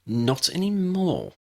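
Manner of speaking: high, slow smirk; smug villainess. A narrator's reading. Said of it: "Aloria smirked".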